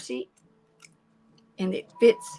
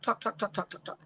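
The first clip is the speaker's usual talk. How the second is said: fading repetition; 8 dB quieter than usual. Real, not acted. Describing her morning chatter to viewers, fading out. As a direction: talk talk talk dwindling softer and softer.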